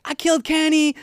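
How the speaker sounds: high, loud playful yell of a catchphrase; mock triumphant shout. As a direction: imitate a kid shouting it out for laughs. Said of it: "And I'd be like".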